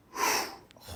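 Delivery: loud, heavy exhale; heavy sigh. Retold or imitated. Driver hearing the insurance information was deleted, heavy relieved sigh. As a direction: let out a big breath of relief.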